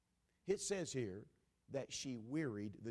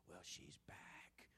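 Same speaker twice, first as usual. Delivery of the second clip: hushed unvoiced whisper, slow; 10 semitones lower, 19 dB quieter, much slower than usual. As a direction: lean in and whisper it secretly. Judge muttering to assistant.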